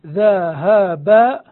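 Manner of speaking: "ذهب" loud and very slow, split into dha, ha, ba; syllable by syllable. Shown as a demonstration. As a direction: punch each open syllable of "ذهب" separately. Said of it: "الأصل في ذهبت". ("ذهب" بصوت عالٍ، مقطعًا مقطعًا: ذَ، هَـ، بَ)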